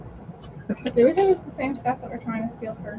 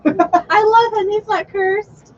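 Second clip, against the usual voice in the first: high mock devotion; 8 semitones higher, 3 dB louder, slower than usual. Playing a devoted cultist.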